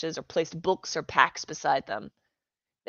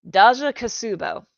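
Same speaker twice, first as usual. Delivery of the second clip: loud proud; 4 semitones higher, 9 dB louder than usual. Acted.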